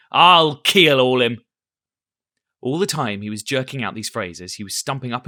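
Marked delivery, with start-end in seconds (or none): 0.1-1.4 loud, slow threat; blustering threat. A narrator's reading. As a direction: drag out the vow. Silver's show of excitement.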